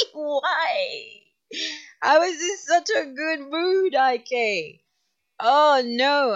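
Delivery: high, loud mock sobbing; laughing lament. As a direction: wail in playful despair, half laughing. Laughing lament over ruined mood.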